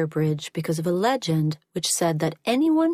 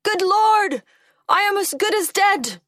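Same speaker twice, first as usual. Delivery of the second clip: high, loud shout of alarm; shouted cry; 14 semitones higher, 5 dB louder than usual. A narrator's reading. Old man falling on the cursed bridge, high alarmed shout. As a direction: cry out in high-pitched dismay. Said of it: "he shouted".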